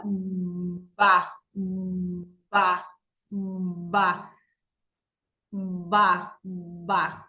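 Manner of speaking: Ba with a hum of voicing before the lips open; prevoiced b demo. Shown as a demonstration. Teacher showing voicing before Ba. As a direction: buzz first, then release Ba. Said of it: "puedo hacer una vibración previa".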